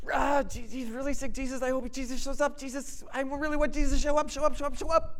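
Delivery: high, loud, urgent begging voice; anxious pleading sisters. Retold or imitated. Sisters begging Jesus to come.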